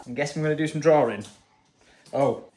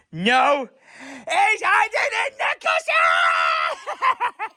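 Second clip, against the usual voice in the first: excited shout; 6 dB louder, much slower than usual. Real, not acted. Finding child in play.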